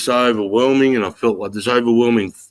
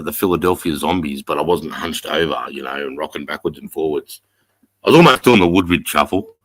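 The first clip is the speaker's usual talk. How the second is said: normal talking voice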